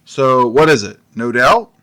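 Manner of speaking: exasperated. Acted. Detective pressing the captain, exasperated. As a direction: lose patience with the captain.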